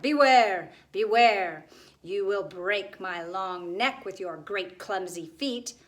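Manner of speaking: loud, menacing gargoyle warning; wicked gargoyle. A narrator's reading. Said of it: "wicked chuckle of a gargoyle".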